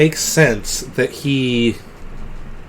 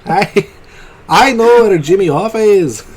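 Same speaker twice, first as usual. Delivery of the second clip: higher-pitched hammed-up Irish caller; Irish accent; 4 semitones higher than usual. Phoning 911 about Hoffa, mock Irish accent. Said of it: "pretend I got an Irish accent".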